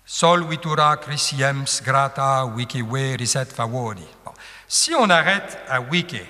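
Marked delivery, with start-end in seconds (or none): 0.0-4.0 "Sol vitura crisiems" recited evenly with each long syllable leaned on; scanned Latin verse. Shown as a demonstration. Teacher reads Horace's first line for students, metrical recitation. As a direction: read the line in strict metre. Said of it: "quand je vais le lire, vous allez le sentir".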